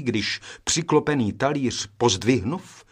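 ordinary delivery

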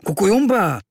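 high, loud reproachful cry. Acted. Kvíkvék reproaching his friend for waking him. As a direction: scold him with one word. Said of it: "řekl trochu vyčítavě".

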